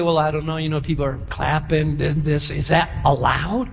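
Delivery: low, hesitant mock-worried voice; doubtful visitor. Newcomer asking about clapping in church, low and doubtful.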